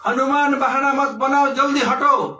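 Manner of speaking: high, loud scolding; stern rebuke. Acted. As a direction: scold him to move now. Ram rebuking Hanuman.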